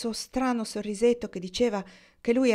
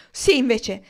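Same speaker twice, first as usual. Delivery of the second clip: loud, raised, defiant cry; higher-pitched, forceful outburst; shouted retort; 4 semitones higher, 10 dB louder, slower than usual. A narrator's reading. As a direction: shout back in protest. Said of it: "gridò lei".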